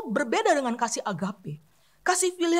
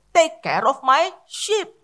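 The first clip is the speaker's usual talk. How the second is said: slow deliberate; 4 dB louder, much slower than usual. Shown as a demonstration. Preacher reading Jesus' second command slowly to the church. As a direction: Take care of my sheep drawn out very slowly. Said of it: "Yang kedua adalah".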